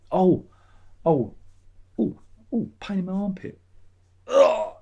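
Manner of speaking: high mock pained oh's; fake pain. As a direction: pretend a sharp armpit pain. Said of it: "was joking".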